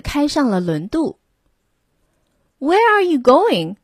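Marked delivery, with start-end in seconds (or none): 2.6-3.8 higher, louder hearty call; curious sailors. A narrator's reading. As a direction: shout the question across the deck. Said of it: "asked a group of sailors".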